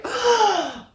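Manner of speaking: high theatrical gasp; mock shocked gasp. Storyteller reacting to the boy in the shop, mock gasp. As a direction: gasp as if scandalised, hammed-up.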